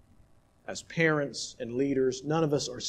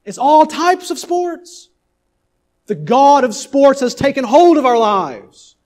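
high, loud pulpit preaching; preaching at full force; 10 semitones higher, 13 dB louder than usual. Acted. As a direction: thunder it out to the congregation. Opens sermon at full force.

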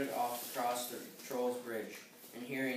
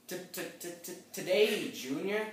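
higher, mocking stutter; impatient mock stammer; 6 semitones higher, 3 dB louder, much slower than usual. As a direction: mimic the stammer to hurry them along. Troll mocks the stammering goat.

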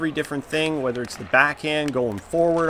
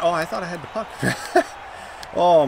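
normal talking voice

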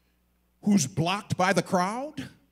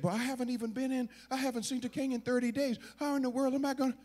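higher, rapid-fire objection; flustered protest; 8 semitones higher, faster than usual. Acted. Esther telling Mordecai she cannot see the king, flustered. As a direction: rattle off excuses, pitched up.

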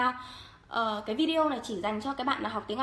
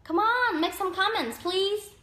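high, drawn-out plea to viewers; playful pleading; 7 semitones higher, slower than usual. Real, not acted. Asking live viewers to make some comments, playful high-pitched pleading. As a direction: beg them, pitched way up.

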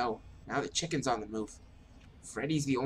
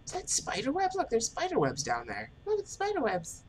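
squeaky high voice; 14 semitones higher than usual. Acted.

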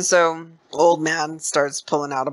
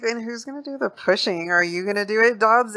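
lightly voiced, higher-pitched old man; a hint of an old prospector, pitched up; old dude; 7 semitones higher than usual. Old dude challenging Dobbs on who'll push, lightly voiced old man. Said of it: "the old dude's like".

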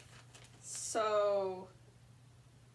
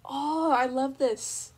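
delighted; 3 semitones higher, 10 dB louder, much slower than usual. Real, not acted. Delight, slow.

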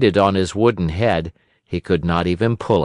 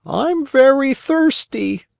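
very high, slow bird voice; thirsty pigeon; slower than usual. A narrator's reading. Pigeon after flying all day, high and drawn-out. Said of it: "the pigeon said".

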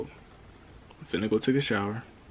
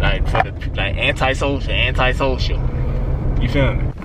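muffled behind a mask; through a face mask; 8 dB louder than usual. Real, not acted. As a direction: talk through a cloth mask. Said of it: "I'm wearing a mask right now".

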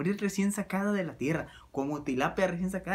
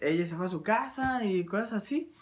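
ordinary delivery

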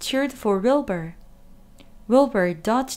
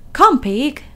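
higher, louder coaxing call; calling the pig; 6 semitones higher, 8 dB louder than usual. A narrator's reading. Farmer calling his pig, high and loud. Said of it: "said Mister Zuckerman".

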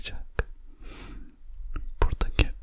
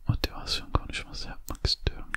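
normal talking voice

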